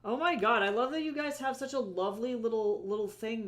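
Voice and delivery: loud, high delighted gush; excited gushing. Real, not acted. Gushing over friends' setup.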